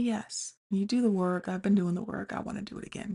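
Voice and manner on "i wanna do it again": whisper. Real, not acted. Aside admission.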